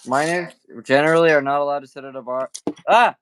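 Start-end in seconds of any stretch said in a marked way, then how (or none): 2.9-3.2 sudden startled yelp, louder; jolted by a near drop. Real, not acted. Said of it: "I almost dropped my phone".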